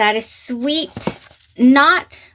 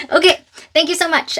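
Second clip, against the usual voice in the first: teary thanks; 6 semitones higher, faster than usual. Real, not acted. Emotional thanks to the company.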